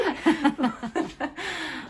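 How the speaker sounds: delighted high laugh. Real, not acted. Laughing after praising the accent.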